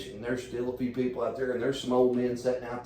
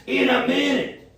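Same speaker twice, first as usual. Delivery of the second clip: "In a minute" drawn out slowly and louder, a lightly voiced grumpy man; grumpy man behind door; 8 dB louder, slower than usual. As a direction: mimic the annoyed guy on the toilet, slow and loud. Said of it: "that's how he sounded".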